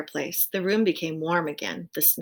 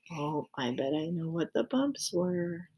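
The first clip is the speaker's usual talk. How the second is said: soft aside; 2 semitones lower, 6 dB quieter than usual. Real, not acted. Aside to child listeners.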